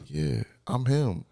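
slow, smug "I'm him"; cocky swagger. Retold or imitated. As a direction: drawl it out like the big man. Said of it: "they be like".

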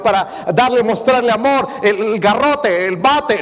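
high, loud, driving sermon voice; fiery preaching. Acted. Pastor preaching to the congregation about the rod, fiery. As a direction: hammer the point home from the pulpit.

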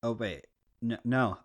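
ordinary talk

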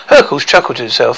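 usual speaking voice

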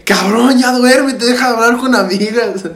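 high-pitched mock nagging; retold bedtime complaint. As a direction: imitate an exasperated roommate, pitched well up. Voices complaint to roommate at bedtime.

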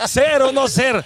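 high, loud theatrical declamation; mock-dramatic stage delivery; Naredo. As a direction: proclaim it like a hammy Shakespearean actor. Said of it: "Y estaba Naredo".

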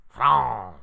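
France shouted in a mock French accent; loud French accent attempt. Shown as a demonstration. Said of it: "I can't do it".

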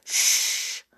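long, voiceless shush; hushing. A narrator's reading. George warning the others of someone coming, hushing. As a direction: hush them with a sustained shh. Hiding children hush each other.

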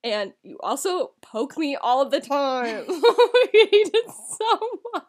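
laughing through the words; can barely talk for laughing. Real, not acted. Sister laughing about being poked.

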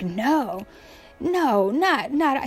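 swooping, repeated refusal; mock protest. Peter objecting to Jesus being crucified, mock protest. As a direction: act out a dismayed objection.

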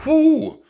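loud, high owl's hoot. A narrator's reading. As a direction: hoot like a big snowy owl. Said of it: "said the owl".

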